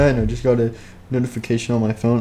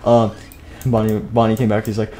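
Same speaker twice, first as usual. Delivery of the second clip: ordinary delivery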